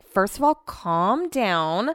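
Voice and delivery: slow, drawn-out retort; telling to calm down. Retold or imitated. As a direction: stretch it out to settle someone worked up. Telling upset partner to calm down.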